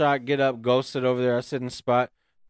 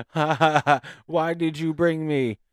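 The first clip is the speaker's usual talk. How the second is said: mocking laugh, higher and drawn out; slow, higher mock giggle into the question; imitated giggling; 4 semitones higher, slower than usual. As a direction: imitate a wife laughing at you. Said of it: "So she's giggling now".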